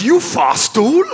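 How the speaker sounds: high, slow lilt in a mock Welsh accent. Comic offers the Welsh for youth hostel, mock Welsh accent. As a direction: say it loud with a questioning Welsh rise. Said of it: "In the Welsh".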